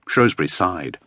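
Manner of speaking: ordinary delivery, nothing marked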